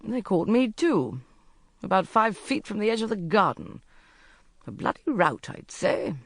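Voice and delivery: grim. A narrator's reading. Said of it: "Robin said grimly".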